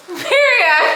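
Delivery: high squeal of delight. Real, not acted. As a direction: squeal it with joy. Excited squeal over gift.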